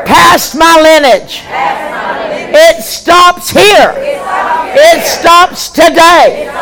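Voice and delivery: slow, drawn-out, shouted, each phrase hammered; preached declaration. Acted. Pastor breaking a family curse, slow thundering preaching. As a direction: declare it slowly with full force.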